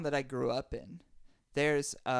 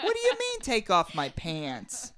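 shocked; 6 semitones higher than usual. Acted. Grandpa shocked by request.

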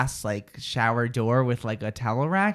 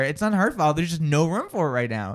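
normal talking voice

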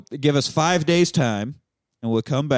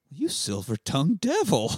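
softer, playfully voiced character line; Christoph Waltz; 3 semitones higher, 5 dB quieter than usual. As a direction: lightly imitate an amused film character. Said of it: "Christoph Waltz goes".

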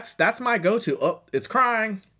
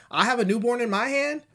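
normal talking voice

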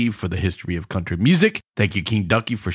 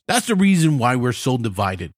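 ordinary delivery

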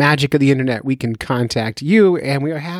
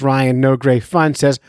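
ordinary talk, nothing marked

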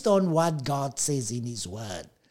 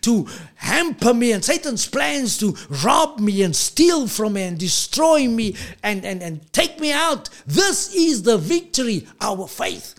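higher, rising sing-song sermon climax; preaching at full force; 5 semitones higher, 4 dB louder than usual. Acted. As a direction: preach it up to a rousing peak.

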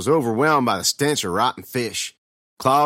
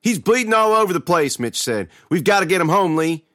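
high, alarmed raised voice; panicked urgency; 7 semitones higher, 4 dB louder than usual. A narrator's reading. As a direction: urge your brother to act at once. Mitch panics over bleeding brother.

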